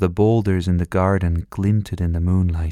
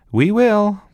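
put-on eager child; 14 semitones higher, 5 dB louder than usual. Child calls cheerful answer.

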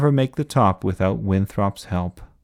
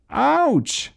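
very high little yelp; crying out. A narrator's reading. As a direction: squeak it like a bump. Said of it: "cried Augustus".

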